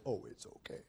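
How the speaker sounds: soft whispered indulgent grandpa; a mild whispered grandfather voice waving sin away; doting grandfather. Retold or imitated. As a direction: brush it off gently like a doting granddad. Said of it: "a doting grandfather who says".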